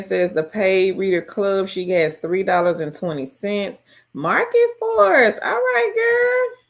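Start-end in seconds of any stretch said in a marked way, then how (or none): 4.1-5.4 excited. Real, not acted. Excited shoutout to a viewer. 5.4-6.6 high, excited squeal; excited cheer. Real, not acted. Cheering a viewer's earnings.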